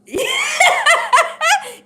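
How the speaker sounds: a big shrieking burst of laughter; loud high laugh. Real, not acted. Reacting to a guest's confession with a loud laugh.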